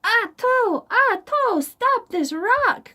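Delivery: high, loud frantic plea; crying king. Acted. King crying out frantically under the bouncing rock. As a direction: beg frantically as the rock hits you. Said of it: "cried the king".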